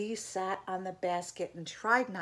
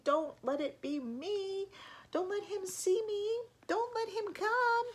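high whimper climbing higher with each plea; 13 semitones higher than usual. A narrator's reading. Eddie hidden in the basket begs not to be found, whimpering. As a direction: beg like a frightened small child. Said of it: "whimpered Eddie".

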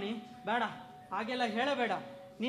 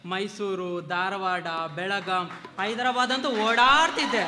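raised voice; 4 semitones lower, 7 dB louder, slower than usual. Acted. Husband protests in a quarrel.

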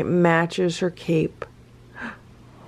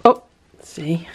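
ordinary talk, nothing marked

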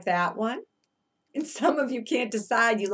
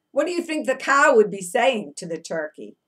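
usual speaking voice

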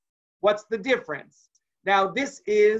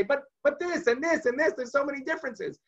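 put-on high excited child's protest; the child bursting in with all the differences; eager kid; 7 semitones higher than usual. Child answering at the seder table, high and eager.

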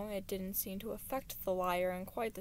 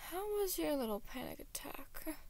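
mocking question that starts high and slides down; sneering; 6 semitones higher than usual. A narrator's reading. Villain taunting captive about his panic, sneering. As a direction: taunt with a sneer of false interest. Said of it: "Deceit sneered".

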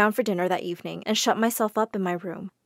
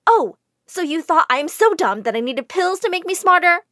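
high, loud sarcastic jab; mock her reasoning; sarcastic anger; 7 semitones higher, 5 dB louder than usual. A narrator's reading. Jane to the widow, sarcastic anger.

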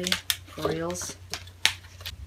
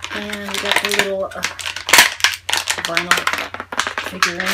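talking over crinkling packaging; noisy unwrapping while talking; crunching sounds; 7 dB louder than usual. Real, not acted. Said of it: "Sorry for all the crunching sounds".

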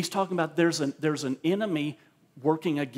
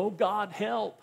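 mock pleading; 6 semitones higher, slower than usual. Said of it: "people look to heaven and go".

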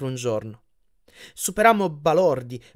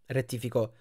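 usual speaking voice

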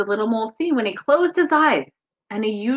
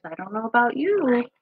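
usual speaking voice

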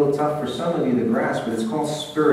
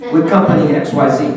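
suddenly louder company name; imitating a guest who gets louder for the company; 7 dB louder than usual. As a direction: punch the company name after a weak name. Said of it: "their voice goes up for the company".

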